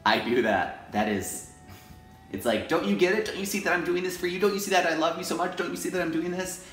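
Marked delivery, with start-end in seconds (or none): 2.7-6.6 exasperated pleading. Retold or imitated. Voicing her needy inner demands.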